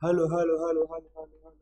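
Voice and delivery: string of hellos fading out; imitated repeating echo. Shown as a demonstration. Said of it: "પડઘો એટલે".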